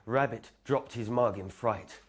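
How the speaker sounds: plain reading voice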